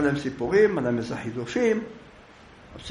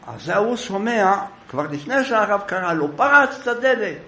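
usual speaking voice

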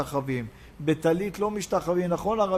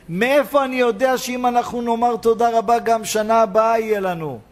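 raised, ringing sermon delivery; impassioned preaching; 3 semitones higher, 5 dB louder than usual. Acted. Preacher promising blessing to the thankful, impassioned preaching. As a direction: proclaim the promise with long, loud phrase endings.

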